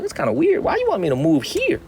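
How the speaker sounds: hammed-up disbelief, then gushing about Shenzhen; replaying his own comeback to the assistant; retelling his own reply. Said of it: "I was like".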